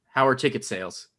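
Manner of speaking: ordinary talk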